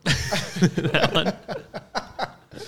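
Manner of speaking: nervous giggly talk; laughter turning nervous. Real, not acted. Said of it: "That nervous laugh".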